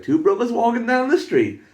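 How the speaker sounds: high, loud mock street announcement; hollering. Retold hollering along the street.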